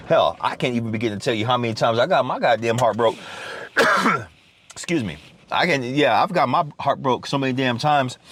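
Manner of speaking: ordinary talk, nothing marked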